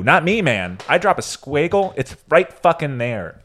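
loud, mocking stand-up delivery; loud stand-up rant. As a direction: belt it like a comedy bit. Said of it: "So this is his bit".

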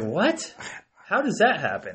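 high, loud disbelieving outburst; incredulous what. Retold or imitated. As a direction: blurt it out in shocked disbelief. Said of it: "I'm like".

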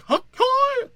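very high, loud yelped syllables; mock screaming. Comedian imitating a name in another language, mock screamed yelp. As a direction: yelp it out as a caricatured shriek. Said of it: "always like that screaming".

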